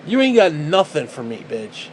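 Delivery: usual speaking voice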